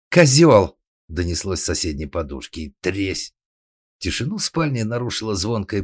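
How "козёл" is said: high, loud outraged shout; sudden furious outburst; angry yell. A narrator's reading. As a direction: hurl the insult at full volume. Wife yells from next pillow.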